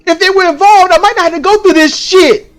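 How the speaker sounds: high, forceful, fed-up outburst; exasperated rant. Retold or imitated. Teller fuming about family and the computer, exasperated rant.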